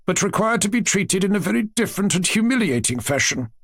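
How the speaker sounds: rehearsed scolding speech from a grandmother; formal, reproachful lecture; prepared reproach. A narrator's reading. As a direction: deliver a prepared rebuke, pitch climbing on the accusations. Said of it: "tone which clearly showed that her speech had been prepared".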